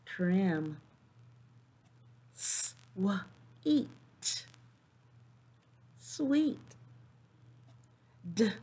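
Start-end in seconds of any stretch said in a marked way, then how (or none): 2.3-3.9 "S, w, eat" said sound by sound with gaps; phonemes broken apart. Shown as a demonstration. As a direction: segment the word into its sounds. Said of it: "I'm gonna break the phonemes".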